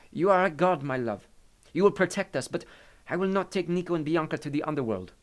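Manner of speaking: put-on trusting but firm, in an Italian accent. A narrator's reading. Maria refuses Hades lovingly, in an Italian accent.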